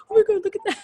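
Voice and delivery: high, loud, amazed exclamation; amazed cast gasp. Retold or imitated. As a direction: gasp at the costume. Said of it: "And they're like".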